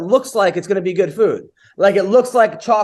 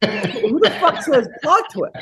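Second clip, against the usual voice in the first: slow, disbelieving retort; incredulous; 5 semitones higher than usual. Retold or imitated. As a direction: drag it out like you can't believe it. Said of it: "I go".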